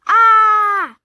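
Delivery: high, loud shocked shout; a startled yelp of alarm; shocked cry. A narrator's reading. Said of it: "cried Broccoli".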